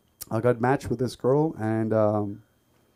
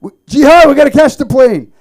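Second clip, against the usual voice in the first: very loud, high shout across an airport; yelling a name; 19 dB louder than usual. Pretend. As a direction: call out to her across the terminal. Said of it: "yell her name".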